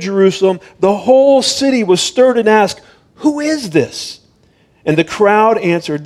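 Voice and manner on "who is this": high, slow astonished ask; wondering question. A narrator's reading. Stirred city asking who Jesus is, high and astonished. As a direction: sound like a startled city asking.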